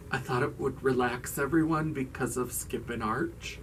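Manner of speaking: soft, low, strange explanation; oddly subdued. Retold or imitated. Muffy explaining the tea to everyone, oddly subdued. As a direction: imitate her oddness, keeping it hushed. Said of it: "she's talking very strange".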